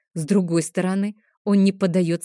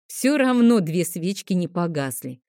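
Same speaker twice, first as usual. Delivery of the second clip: plain reading voice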